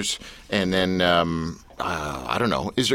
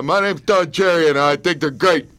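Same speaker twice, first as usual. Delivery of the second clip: Don Cherry impression; 5 semitones higher than usual. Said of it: "He just sounds like a meathead".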